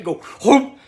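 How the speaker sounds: loud mock whoosh of a flushing toilet; flush sound. Narrating the prison toilet flush with a loud whoop. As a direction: blurt the flush noise big.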